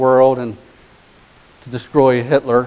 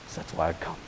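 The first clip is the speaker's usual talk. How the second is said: whisper; 6 semitones lower, 17 dB quieter, faster than usual. Real, not acted. Whispered line in sermon.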